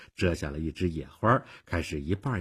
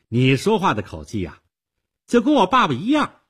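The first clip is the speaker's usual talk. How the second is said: put-on high, loud, tart girlish retort; biting young girl; cutting; 11 semitones higher, 9 dB louder than usual. A narrator's reading. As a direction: snipe back with rising pitch. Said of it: "他尖刻地说".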